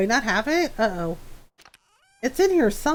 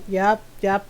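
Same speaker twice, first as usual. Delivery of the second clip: normal talking voice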